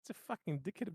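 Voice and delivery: ordinary delivery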